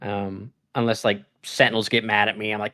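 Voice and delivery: ordinary delivery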